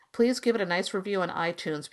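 usual speaking voice

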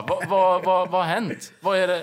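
loud, high, repeated what's wrong; anxious shouted questions. Retold or imitated. Shouting back to the neighbor to ask what's wrong. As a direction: panicky calling out.

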